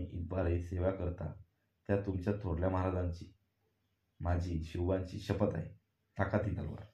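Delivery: ordinary delivery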